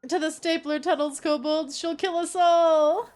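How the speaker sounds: a hammed-up herald of doom; dramatic voice line. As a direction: warn them with doomed drama. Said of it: "picked up the tone of that voice line".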